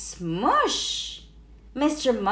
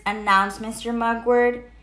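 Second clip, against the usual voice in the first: usual speaking voice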